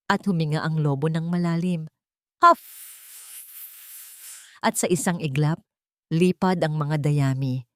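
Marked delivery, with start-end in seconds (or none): 2.4-2.9 loud, high puffed-out blow; big huff. Acted. The wolf blowing down the straw house with a loud huff. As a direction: blast the breath like a huffing wolf.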